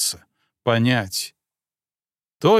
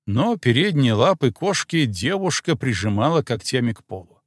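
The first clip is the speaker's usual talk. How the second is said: plain reading voice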